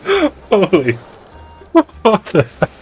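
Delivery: high-pitched surprise. Real, not acted. Reacting in a high voice.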